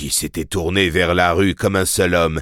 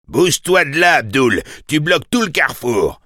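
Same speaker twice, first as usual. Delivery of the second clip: high, raised shout; angry motorist; 8 semitones higher, 3 dB louder than usual. A narrator's reading. Motorist shouting through his window at the taxi, irate. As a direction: yell at the blocking taxi.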